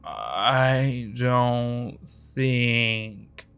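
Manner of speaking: very slow, dazed drug-slurred speech; fuzzy confused voice. Acted. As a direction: drag it out, stoned and lost. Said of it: "Bruno's fuzzy, confused voice".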